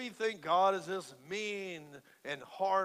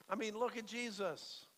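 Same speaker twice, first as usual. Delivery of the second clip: ordinary delivery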